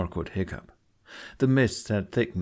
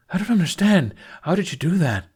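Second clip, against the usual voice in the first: high, loud, breathless disbelief; rushed, breathless confusion; baffled and rushed; 9 semitones higher, 6 dB louder than usual. A narrator's reading. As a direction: blurt it out in astonishment. Speedy Fist baffled, rushed question.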